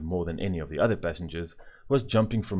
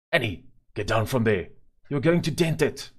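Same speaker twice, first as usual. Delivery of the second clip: louder, rising scolding father; stern order; 4 semitones higher, 5 dB louder than usual. Acted. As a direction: order her down firmly. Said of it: "ordered father".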